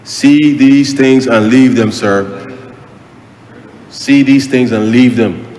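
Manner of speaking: slow, weighted plea, each word leaned on; slow it right down and plead; slow earnest plea. Real, not acted. MP pleading with the public to avoid the scam, slow and weighted.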